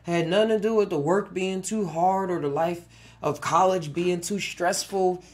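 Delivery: ordinary delivery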